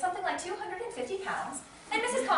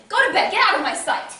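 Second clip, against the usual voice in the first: loud, sharp order to a daughter; angry dismissal; 8 dB louder than usual. Acted. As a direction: send her away in a temper. Mother angrily banishes daughter.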